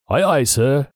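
a lightly voiced pirate cry. A narrator's reading. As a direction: call it back like eager pirate hands. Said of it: "the pirates cried".

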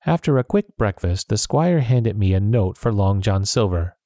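usual speaking voice

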